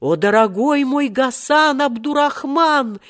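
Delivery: high, emotional exclamation; rising, astonished joy; overjoyed cry. A narrator's reading. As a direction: cry out to a long-lost brother. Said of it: "вскричал в свою очередь Омар Юсуф".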